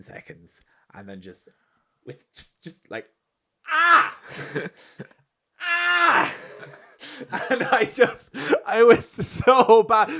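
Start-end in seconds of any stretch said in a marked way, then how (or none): 3.7-4.2 high, loud cringe scream; embarrassed scream. Retold or imitated. Walking home, screaming at the memory, high and loud. 5.6-6.3 high, loud cringe scream; embarrassed scream. Retold or imitated. Walking home, screaming at the memory, high and loud.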